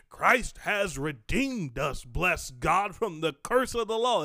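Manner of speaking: punched, drawn-out preacher's proclamation with sass; sassy preaching. Retold or imitated. Imitating preachers who get sassy about redemption, sassy preaching. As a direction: preach it with fire and moxie. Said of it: "sassy. They get a little sassy".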